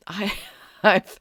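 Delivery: real laugh breaking in. Real, not acted. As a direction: laugh at the absurdity mid-word. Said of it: "I'm laughing because it's so absurd".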